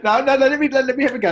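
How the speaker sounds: laughing protest. Real, not acted. Guest cutting off the host's hint, laughing and eager. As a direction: jump in eagerly through a laugh. Laughing, insists on answering himself.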